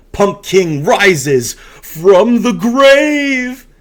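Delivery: hammed-up big reveal; dramatic announcement. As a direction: announce the rising like a showman. Dramatic climax of story reading.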